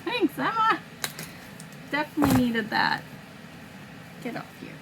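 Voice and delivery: wrestling a climbing cat; struggling with my cat. Real, not acted. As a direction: talk while pulling the cat off. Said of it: "me struggling with my cat".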